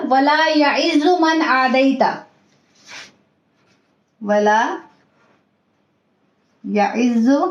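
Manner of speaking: recitation. Shown as a demonstration. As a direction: model each phrase for the class. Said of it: "teacher models dua". (धीमी तिलावत)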